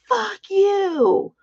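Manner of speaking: high, loud retold curse flung downward; furious yell. Stuck on the ski lift, cursing at the laughing skiers below, high furious yell.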